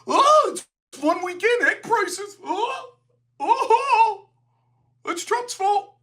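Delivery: high-pitched, sneering mock whine; facetious mockery. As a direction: ham up a whiny partisan blaming Trump. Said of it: "be facetious".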